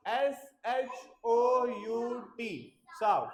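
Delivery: slow, letter-by-letter spelling. Shown as a demonstration. Teacher spelling shout for the class, slow spelling-out. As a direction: spell it slowly, each letter on its own.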